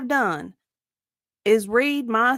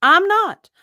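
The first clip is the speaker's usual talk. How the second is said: sharp, higher and louder refusal; firm refusal; 6 semitones higher, 7 dB louder than usual. Real, not acted. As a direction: cut him off, you won't do this. Witness refusing under questioning.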